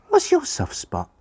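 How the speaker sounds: put-on very high, piping kid voice; eager children's question. Children racing to ask Pa first, high eager voice.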